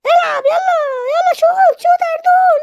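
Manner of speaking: high-pitched shout like a fan at a match; excited cheering. Acted. Fan cheers a player to shoot, excited shouts.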